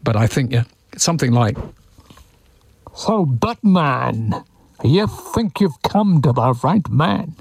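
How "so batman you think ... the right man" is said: slow, menacing masked villain, muffled through hands; Bane voice. Retold or imitated. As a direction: growl it slowly through cupped hands over the face. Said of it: "I can quite do the voice".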